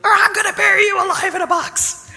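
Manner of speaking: lightly voiced, hushed mock threat; a hint of exasperated teacher; mock exasperated Jesus. Jesus to his disciples, half-joking and exasperated. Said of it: "Jesus just wanted to say".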